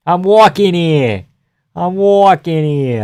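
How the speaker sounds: mock East Coast street tough in a Boston accent; hammed-up Boston accent yelling at traffic; mock Boston tough guy. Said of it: "they do Boston accents sometimes".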